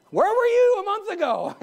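high-pitched, hammed-up challenge; mock indignant complaint. Preacher imitating a late critic of the project, mock indignant. Mimics a critic after the fact.

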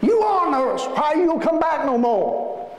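imitating an old man calling out in high-pitched complaint; old man pleading. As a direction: wail the question, stretching it out. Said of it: "he says to her".

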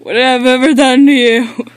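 loud, high-pitched complaint; wailing protest. Acted. Guard protesting to the one bothering him, loud wail.

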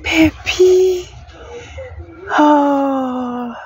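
high-pitched, stunned reaction; amazed squeal, acted out; retold shock. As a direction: re-enact being shocked, voice shooting up high. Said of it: "I was shocked. I'm like".

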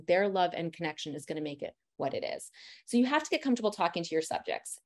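usual speaking voice

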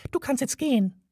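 high, tiny mouse voice, dismissive; a mouse sending him away; curt mouse. A narrator's reading.

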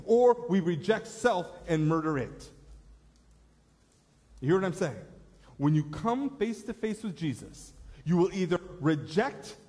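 full-force sermon climax; preaching. Real, not acted. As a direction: press the choice on the congregation. Preaching the altar-call point.

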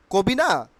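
ordinary talk

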